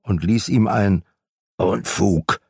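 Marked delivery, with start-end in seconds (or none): 1.6-2.4 put-on old miser growling at his nephew; growled. A narrator's reading. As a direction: snarl the word out in disgust. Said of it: "knurrte er nur abermals".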